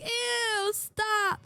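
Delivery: high squeaky "ew, stop"; mock disgusted friend. Imitating Kamimi recoiling from the Riz face, high and squeaky. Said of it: "she'll go like".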